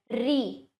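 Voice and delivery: री said clearly as "ree"; pronunciation of ri. Shown as a demonstration. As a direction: say री as a plain "ree", not "rishi". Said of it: "पर इसका उच्चारण क्या है". (री को साफ़ "री" बोलिए)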